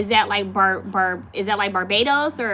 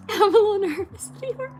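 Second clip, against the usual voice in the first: laughing nervous; 12 semitones higher than usual. Real, not acted.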